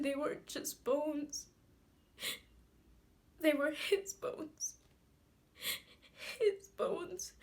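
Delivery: high, loud voice breaking into sobs; tearful grief. Acted. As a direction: choke the words out between sobbing breaths, pitched high. Grieving monologue about dead father's grave.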